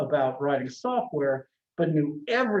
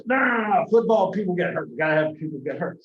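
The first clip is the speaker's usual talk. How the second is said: John Madden voice; 3 semitones higher, 3 dB louder, faster than usual. Retold or imitated. Madden insisting to the developers that players get hurt. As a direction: brush off the objection like an excited coach. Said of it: "he kinda talks like this".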